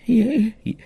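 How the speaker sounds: high, cracking, scared yes sir; hammed-up frightened squeak to a mobster; nervous squeak. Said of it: "I was like".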